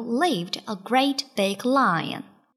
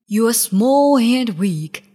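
loud, scornful big lion; 7 dB louder than usual. A narrator's reading. Lion taunts the jungle animals, loud bullying voice. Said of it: "said the lion".

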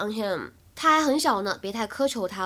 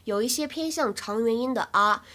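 ordinary talk

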